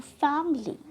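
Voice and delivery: "फैमिली" said as फैम-ली, first part stressed; two-syllable pronunciation. Shown as a demonstration. Teacher showing learners the right form, "फैमिली" in two syllables, फैम-ली. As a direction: squeeze "फैमिली" into फैम-ली, weight on फैम. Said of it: "हमने फैम को बोलते वक्त trust किया".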